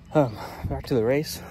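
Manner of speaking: normal talking voice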